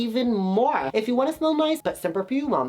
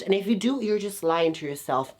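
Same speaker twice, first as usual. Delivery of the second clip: normal talking voice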